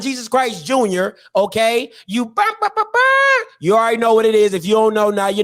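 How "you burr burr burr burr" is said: high playful burr noises; mock burr sounds. Hyping up the coach, high playful burr ad libs. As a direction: rattle off burrs like a hype ad lib.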